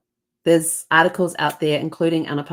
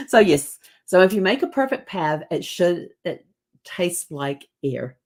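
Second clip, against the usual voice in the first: ordinary talk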